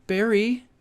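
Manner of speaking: high, thin-voiced pleading imitation; imitated girlfriend. Girlfriend wanting boyfriend to talk, high imitated voice. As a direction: lift the voice high, calling for attention. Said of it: "she's like".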